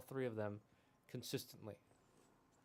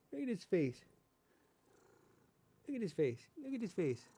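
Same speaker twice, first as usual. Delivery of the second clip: cooing at cat; 6 semitones higher, 5 dB louder than usual. Real, not acted.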